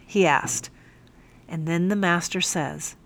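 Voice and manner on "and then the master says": usual speaking voice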